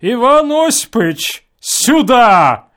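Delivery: sudden loud bark across the hall. A narrator's reading. Someone in a hotel calls for Ivan, barking loudly. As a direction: bellow the name out of nowhere. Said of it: "гаркнули где-то".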